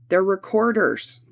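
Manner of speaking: ordinary delivery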